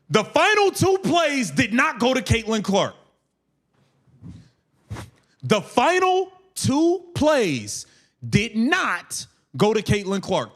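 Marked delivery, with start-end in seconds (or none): 5.4-10.5 slow emphatic repeat. Real, not acted. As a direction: spell it out very slowly, word by word. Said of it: "repeats, slowed".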